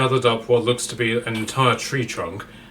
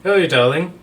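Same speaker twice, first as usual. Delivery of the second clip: higher, posh and teasing, calling everyone darling; posh Frank voice; 6 semitones higher, 5 dB louder than usual. Acted. Captive Frank teasing the hungry troll, posh and camp. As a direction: play a camp captive, amused, high and loud.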